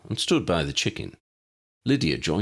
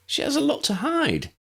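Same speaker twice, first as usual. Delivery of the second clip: lightly voiced Lydia. A narrator's reading.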